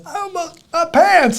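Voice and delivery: high, drawn-out mock scream; slow, loud, high-pitched comic panic; freaked-out scream. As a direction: imitate someone scared out of his wits. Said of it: "screams, and he goes".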